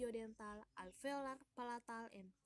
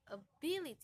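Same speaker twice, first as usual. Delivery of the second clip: "ability" with BIL higher and louder; primary stress; 5 semitones higher, 6 dB louder, faster than usual. Shown as a demonstration. Teacher repeating a primary stress example, "ability" stressed on BIL. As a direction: punch the second syllable of "ability". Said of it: "Primary stress is strong pressure".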